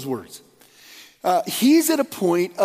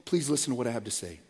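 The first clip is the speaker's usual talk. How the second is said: tender plea; 4 semitones lower, 7 dB quieter than usual. Retold or imitated. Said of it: "he's just coming with that".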